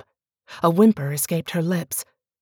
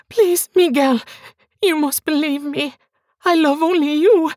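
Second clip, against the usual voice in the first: much higher and louder, begging; desperate pleading wife; 10 semitones higher, 4 dB louder than usual. Acted. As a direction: beg him for belief. Bianca begs.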